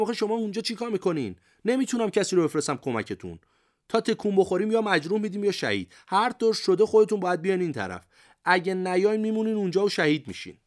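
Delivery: high, loud urgent call; shouting across. A narrator's reading. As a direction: call out to men stranded at a distance. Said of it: "از اونجا داد که زدم".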